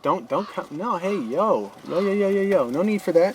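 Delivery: ordinary talk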